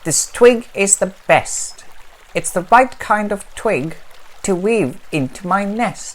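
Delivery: proud nest-building bird; pleased swan. Acted. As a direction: savour the find. Swan speaks.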